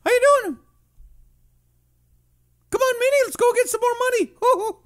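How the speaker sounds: very high falsetto cartoon mouse voice; Mickey Mouse. Host jokes about Florida theme park, cartoon mouse impression. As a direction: squeak it out in a giddy falsetto. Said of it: "right there in Mickey's house".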